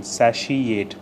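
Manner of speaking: "satiate" sounded out as sa-she-ate; SHE in between. Shown as a demonstration. Teacher spelling out the sound for learners, "satiate" with a clear middle she. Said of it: "with a she in between".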